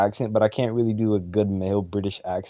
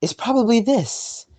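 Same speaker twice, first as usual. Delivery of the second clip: put-on much higher-pitched girl voice; 11 semitones higher than usual. Shown as a demonstration. Showing a friend the closest attempt, high girl voice. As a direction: put on your best attempt at a girl's voice. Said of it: "closest I can get to a girl voice".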